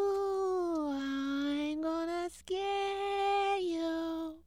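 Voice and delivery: high, soft, slow ghostly moan; spooky ghost voice. Acted.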